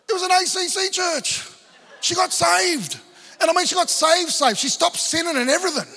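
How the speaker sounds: high, loud, jubilant shout; exultant preacher's climax; triumphant. Real, not acted. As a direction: celebrate the miracle to the crowd.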